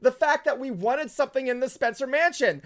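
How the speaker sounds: ordinary delivery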